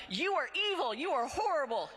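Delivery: hammed-up yelling in her face; acting out the activist screaming at her; acted screaming activist. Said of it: "one-woman show".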